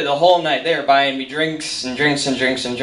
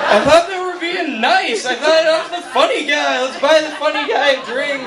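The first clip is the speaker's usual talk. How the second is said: laughing disbelief; 11 semitones higher than usual. Real, not acted. Naive realization, laughing.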